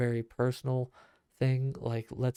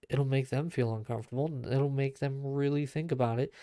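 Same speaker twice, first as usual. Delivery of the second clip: usual speaking voice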